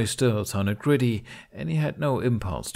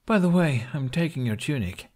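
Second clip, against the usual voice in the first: bright, high teasing tease, pitched up; teasing announcement; 6 semitones higher than usual. A narrator's reading. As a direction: toss it off playfully as you grab his shirt. Kamikaze teasing Hiccup.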